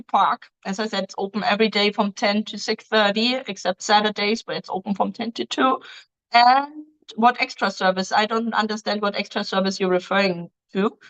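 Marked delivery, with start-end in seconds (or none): none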